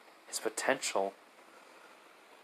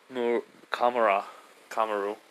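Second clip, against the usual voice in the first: ordinary delivery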